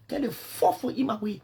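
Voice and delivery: ordinary delivery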